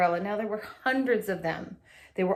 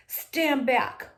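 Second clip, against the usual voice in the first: raised, firm order; warning the crowd; 6 semitones higher than usual. A narrator's reading. Attendant warning the crowd, raised and firm. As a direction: hold people back. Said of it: "one of them said to the crowd".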